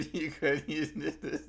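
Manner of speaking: laughing through the words, slow; cracking up at the breakup story. Real, not acted. Friend laughing at the dumped guy, words cracking with laughter.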